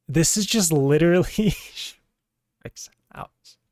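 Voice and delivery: slow, high, laughing disbelief. Real, not acted. Laughing at the game scene.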